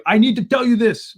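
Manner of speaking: high, loud insistent witness; mock-insistent witness cutting in; quoting the witness. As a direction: push in over the judge, insisting. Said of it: "and then he'd say".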